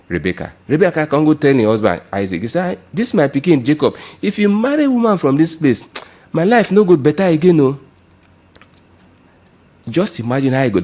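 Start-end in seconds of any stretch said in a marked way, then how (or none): 2.9-7.8 distressed plea. Acted. Rebecca pleading with Isaac.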